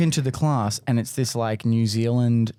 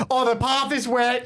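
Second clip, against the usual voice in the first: much higher, slow, delighted; gleeful schoolboy; 11 semitones higher than usual. Retold or imitated. Boy at school thrilled the path is wet, gleeful and slow. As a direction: savour it like a kid seeing mischief ahead.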